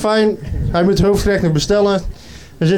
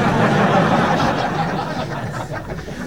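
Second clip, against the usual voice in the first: audience laughter; 5 semitones higher, 5 dB quieter than usual. Real, not acted.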